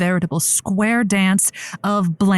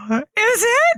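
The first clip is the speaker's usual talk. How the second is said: delighted gasp; 13 semitones higher than usual. Real, not acted. [name] gasps as Kingsley calls in, much higher. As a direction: gasp then much higher squeal of surprise. Excited gasp for returning guest.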